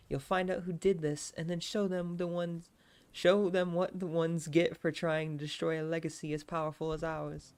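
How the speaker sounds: Alexia. Acted. Alexia tells her brother to punish their attackers, character voice. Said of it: "The Alexia voice is peak".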